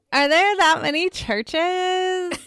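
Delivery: high, slow mock Valley Girl. Co-host re-enacting people asking about churches, Valley Girl voice. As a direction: stretch it out like an incredulous Valley Girl.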